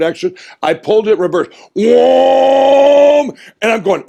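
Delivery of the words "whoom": imitated crane whoosh. Crane swinging in his story.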